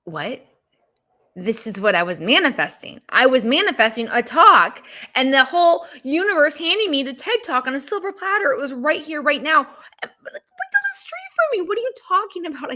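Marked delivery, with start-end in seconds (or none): 3.1-12.7 climbing higher and higher, can't believe it; worked-up disbelief. Retold or imitated. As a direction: voice shoots up high in outraged disbelief. Indignant disbelief retelling the rejection.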